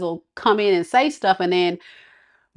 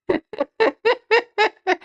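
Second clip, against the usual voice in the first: high laugh; 11 semitones higher than usual. Real, not acted. Laughing at viewers.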